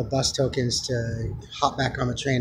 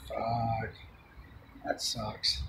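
muttered; 5 semitones lower, 14 dB quieter, much slower than usual. Retold or imitated. Muttered reaction, very soft.

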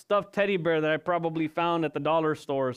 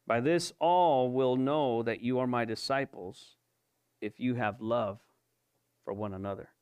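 solemn reading that fades out; scripture reading; 6 semitones lower than usual. Acted. Reads scripture aloud.

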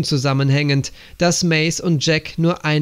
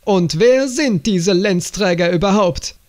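shouting; 4 semitones higher than usual. A narrator's reading. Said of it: "schrie er".

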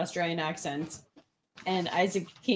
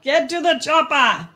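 louder, higher action-hero yell; Arnold; 7 semitones higher, 9 dB louder than usual. Acted. Doing the viewer's line bigger, shouted action-hero impression. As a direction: bellow it like the Austrian action star. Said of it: "They're asking for Arnold".

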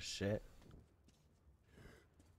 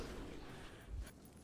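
sharp gasp; 10 dB quieter than usual. Real, not acted. Attacked by enemy.

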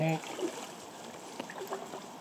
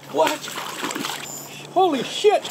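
excited shout; 13 semitones higher, slower than usual. Real, not acted. First sight of huge fish.

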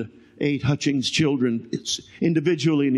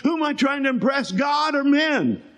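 high, shouted preaching of the verse; fiery scripture reading; 8 semitones higher than usual. Acted. Preacher proclaiming Galatians to the congregation, shouting high.

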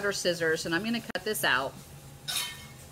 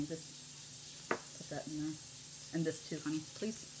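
whispered aside; 6 semitones lower, 17 dB quieter than usual. Real, not acted.

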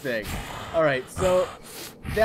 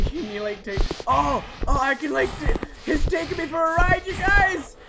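usual speaking voice

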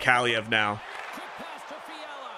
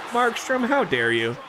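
mock outrage; 10 semitones higher than usual. Real, not acted. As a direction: playfully scold the goalie. Rival goalie makes a save.

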